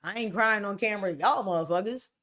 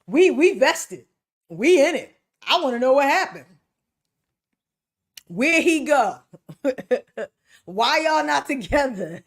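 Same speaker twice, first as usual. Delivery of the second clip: nosy fan; 4 semitones higher, 6 dB louder than usual. Pretend. Viewers pressing an influencer, loud and pushy. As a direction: play the nosy viewer who wants the tea. Voicing nosy fans demanding updates.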